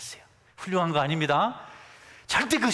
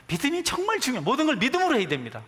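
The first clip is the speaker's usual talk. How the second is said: much higher, fast, urgent preaching; emphatic preaching; 10 semitones higher, 3 dB louder, faster than usual. Acted. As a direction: drive the point home like a pulpit climax. (높고 빠르게 힘주어 설교하듯)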